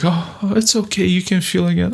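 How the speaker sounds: high, soothing mock fairy. Fairy comforting the macho man, high and soothing. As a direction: coo it up high like a kind fairy. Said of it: "And the fairy's like".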